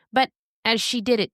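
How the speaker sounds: plain reading voice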